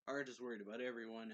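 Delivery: plain reading voice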